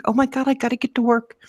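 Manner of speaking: high, fast, frantic line; panicked rush. Retold or imitated. Late for work in the drive-thru, fast frantic voice. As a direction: babble it in a panic.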